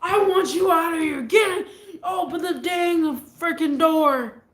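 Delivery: loud, high shouted order; retold angry yell; shouting. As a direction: yell him out of the house. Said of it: "I'm just translating".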